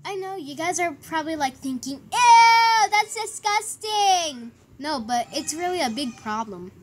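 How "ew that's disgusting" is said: high, drawn-out acted revulsion; mock disgust. Imagined viewers react to the cut, grossed out. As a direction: start loud and stretch out the squeamishness.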